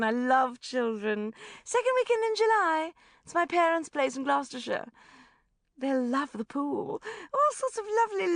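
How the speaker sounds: high, loud society gush. A narrator's reading. Rebecca gushing invitation.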